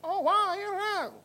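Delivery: very high, loud cartoon character; squeaky high cartoon figure, loud. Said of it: "different voices".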